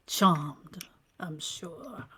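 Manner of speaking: suave whisper. Acted. Steel Fox kisses Miranda's hand, greeting her in a suave whisper. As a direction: seduce her with a hushed, silky greeting.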